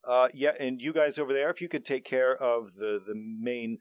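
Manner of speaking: loud, formal manager voice; pompous boss speech. Acted. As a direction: address the group like a pompous manager. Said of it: "leader speech".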